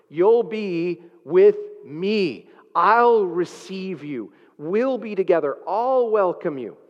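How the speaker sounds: slow, drawn-out promise with each pronoun lifted; tender promise. Shown as a demonstration. Preacher voicing Jesus welcoming the criminal, tender and slow. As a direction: savor each word like a warm welcome. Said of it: "The emphasis is the relationship".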